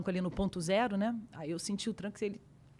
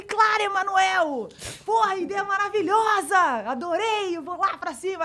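mock eager; 9 semitones higher, 4 dB louder than usual. Retelling eager reply to producer.

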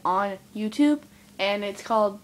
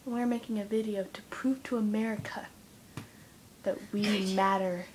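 mock whisper; 4 semitones lower, 8 dB quieter than usual. Mock solemn whisper to camera.

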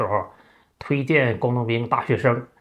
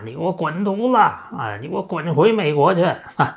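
father scolding; 6 semitones higher than usual. Retold or imitated. 父亲骂儿子滚回美国，大声高声怒吼. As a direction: bark it at the son like a furious old father. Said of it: "他就开始骂了啊".

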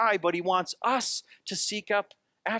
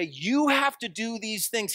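slow, higher, bossy command; pushy demand; 5 semitones higher than usual. Retold or imitated. Imagined pushy God ordering people around, mock-bossy. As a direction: lean on them like a nag. A badgering God grabbing shirt collars.

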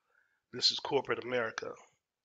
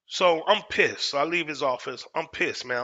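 angry; 3 semitones higher, 7 dB louder than usual. Retold or imitated. Retelling his anger at work.

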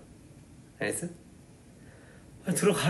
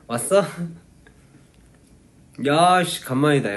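loud, rough buddy welcome; casual friend greeting; 2 semitones higher, 4 dB louder than usual. Shown as a demonstration. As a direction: greet him like a long-lost friend. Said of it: "약간 이런 식으로".

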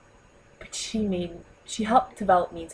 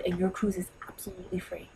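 sales whisper; 8 dB quieter than usual. Retold or imitated. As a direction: whisper it like a secret deal.

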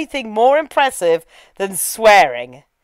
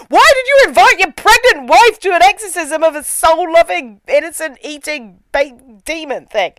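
mock outrage; 5 semitones higher than usual. Host scolds husband who brought pregnant wife, exasperated. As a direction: berate the character in comic disbelief. Outraged rant at film's logic.

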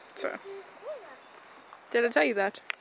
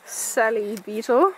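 nature documentary; 6 dB louder, slower than usual. Pretend. Nature documentary narration of friend.

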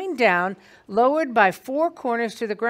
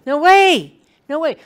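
Peter refusing; 5 semitones higher, 8 dB louder than usual. Acted.

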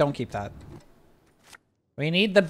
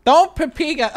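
high protest; 12 semitones higher, 7 dB louder than usual. Real, not acted. Protesting at the game.

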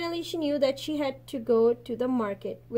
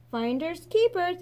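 growl; 6 semitones higher than usual. A narrator's reading. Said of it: "he growled".

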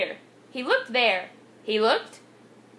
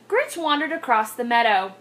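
plain reading voice

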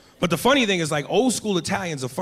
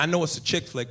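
ordinary talk, nothing marked

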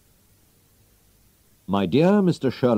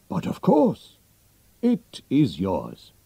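Holmes's voice; 3 semitones higher, slower than usual. Acted. Holmes answers the king.

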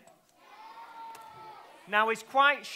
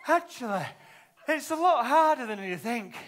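out of breath; 3 semitones higher than usual. Acted. After blowing up a balloon.

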